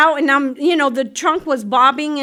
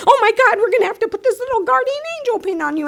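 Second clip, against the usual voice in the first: gushing church lady; 11 semitones higher, faster than usual. Retold or imitated. Excited lady at meeting.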